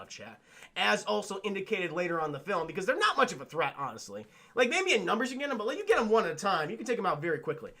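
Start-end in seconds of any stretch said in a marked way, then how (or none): none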